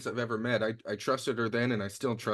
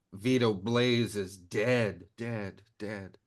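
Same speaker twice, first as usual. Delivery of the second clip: fading echo; 2 semitones lower, much slower than usual. Acted. Reporting a kill, fading echo.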